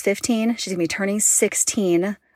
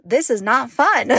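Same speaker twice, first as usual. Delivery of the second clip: exasperated thought; 3 semitones higher than usual. Retold or imitated. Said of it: "And I'm thinking".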